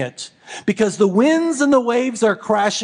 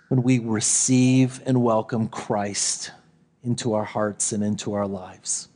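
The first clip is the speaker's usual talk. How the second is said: gentle sermon close; 9 semitones lower, 5 dB quieter than usual. Acted. Pastor inviting the congregation on Christmas Eve, soft and lower. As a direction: invite them quietly to peace.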